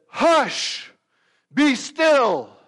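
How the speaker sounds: high, very loud rebuke; forceful, sustained shouted command. Acted. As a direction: order a storm to stop. Jesus commands the storm.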